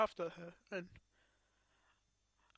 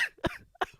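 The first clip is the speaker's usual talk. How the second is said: anguished wailing sob; 4 dB louder than usual. Real, not acted. Sound between shouted lines.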